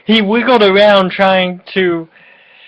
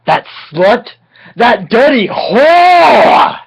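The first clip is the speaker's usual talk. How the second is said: slow, higher, louder mock fury; overacted slow outburst; hammed-up outrage; 4 semitones higher, 3 dB louder, slower than usual. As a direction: drag it out like a melodrama villain.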